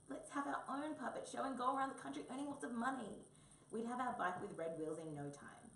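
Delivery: high, excited wombat pitching an idea; eager wombat. Acted. Wombat proposes a puppet show, excitedly. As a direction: blurt out the plan with enthusiasm.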